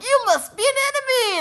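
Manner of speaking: high-pitched mock-dramatic cry; mock accusation. Fan retelling an anime fight, high mock accusation.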